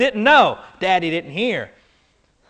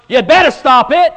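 louder warning, half laughing; stern parent, getting louder; firmer parent warning; 6 semitones higher, 8 dB louder than usual. Retold or imitated. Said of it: "The second word was".

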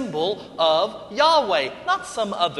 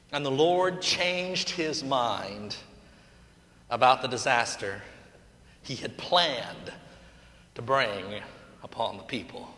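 savoring read; 6 semitones lower, 4 dB quieter than usual. Shown as a demonstration. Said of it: "I just love how it reads".

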